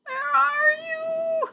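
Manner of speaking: put-on very high thin squeak of a lost baby bat. Lost baby bat calling for her mother, high squeak. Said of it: "Stella Luna squeaked".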